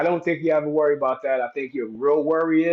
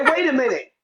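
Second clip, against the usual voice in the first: mock flustered, higher voice; flustered protest; 9 semitones higher than usual. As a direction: stammer out a protest, caught off guard. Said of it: "You say".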